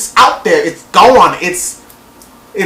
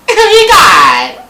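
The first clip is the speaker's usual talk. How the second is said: high, loud gushing squeal; mocking excited-girl voice; imitated catfish caller; 4 semitones higher, 6 dB louder, much slower than usual. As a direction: squeal it like an over-thrilled phone caller. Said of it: "she's talking like that".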